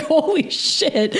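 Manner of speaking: slow, stretched-out awe; awed disbelief. Retold or imitated. Recalling her amazement to a friend, slow awe. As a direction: draw it out as if the size of it just hit. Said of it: "I was like".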